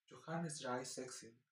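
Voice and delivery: gentle, slow German delivery; soft German. Shown as a demonstration. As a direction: ease "Johannes drei sechzehn" out gently, German style. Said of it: "ellos hablan muy suave".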